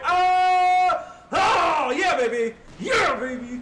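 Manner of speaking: real delighted victory cry; ecstatic shout. Real, not acted. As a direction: yell it like you just won the match. Big gameplay win.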